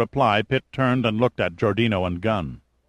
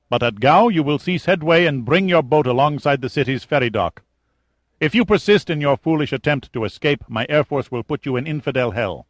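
menacing officer, higher and louder; threatening general; 5 semitones higher, 4 dB louder than usual. Acted. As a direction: lay down terms with cold threat. Kazim threatens.

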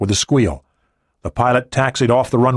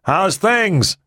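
higher call over the helicopter; shouted question; 9 semitones higher than usual. Acted. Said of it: "Rise shouted".

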